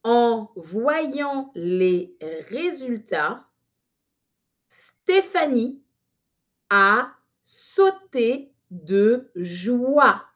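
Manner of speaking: very slow, word by word dictation; drawn-out dictating with pauses. Shown as a demonstration. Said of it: "je répète lentement".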